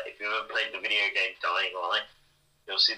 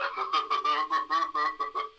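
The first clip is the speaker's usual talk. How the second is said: Honey Monster laugh; 6 semitones higher than usual. Retold or imitated. Host describes Adam's laugh with a light imitated chuckle. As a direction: mimic the friend's chuckle, lightly done. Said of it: "like a Honey Monster kind of laugh".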